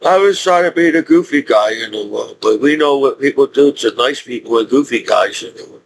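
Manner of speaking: ordinary talk, nothing marked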